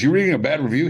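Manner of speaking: imitating the coach scolding him over a review; mock scolding yell. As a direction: stress reading, disbelieving.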